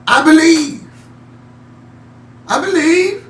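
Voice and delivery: high, loud cry of faith. A narrator's reading. Desperate father crying out to Jesus, high and loud. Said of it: "the Father cries out".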